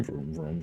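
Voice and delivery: whispered engine vroom; silly clown. Pretend. Engine noise whispered take.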